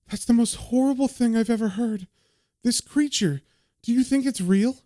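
lightly voiced horrified young woman; [name]'s shocked voice. [name] reacts to horror story.

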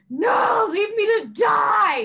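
loud, high, drawn-out mock despair; melodramatic wail. Refusing the roommate's help, melodramatic wail. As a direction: cry it out like a dying martyr. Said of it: "just being like".

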